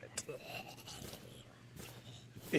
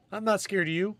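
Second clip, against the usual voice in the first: higher, louder mock tough talk; false bravado; 9 semitones higher, 6 dB louder than usual. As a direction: puff up and pretend to be brave. Taunting stag from hiding.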